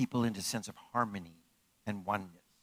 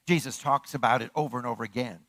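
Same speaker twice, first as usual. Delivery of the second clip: normal talking voice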